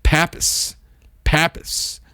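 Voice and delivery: Pappus said breathy and unvoiced, pa-pus; whispered pronunciation. Shown as a demonstration. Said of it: "kinda like P A P I S".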